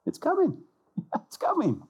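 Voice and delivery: very high, comic falsetto; God comically reassuring. Acted. God answers people who keep asking, comic falsetto. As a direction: squeak it out as a playful put-off. God's comic reply repeated.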